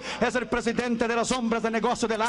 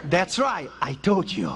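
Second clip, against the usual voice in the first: low, mocking taunt; a hint of a gloating devil; 7 semitones lower than usual. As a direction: gloat over him. The devil taunting the proud man.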